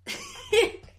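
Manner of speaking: squealing giggle, very high; high burst of laughter. Real, not acted. Retelling the breakup, bursting into high laughter. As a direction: let a helpless laugh squeal out. Laughing at her own story.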